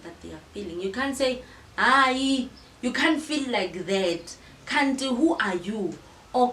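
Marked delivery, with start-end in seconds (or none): 1.8-6.0 louder, brushing off her feelings; dismissive scolding. Retold or imitated. Someone loudly dismissing a friend's feelings, scolding. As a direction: scold as if feelings are silly.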